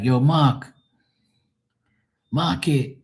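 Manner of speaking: "Mark" with no R, like "Mahk"; Bermudian Mark without R. Shown as a demonstration. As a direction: drop the R in "Mark", long open vowel. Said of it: "There's no R".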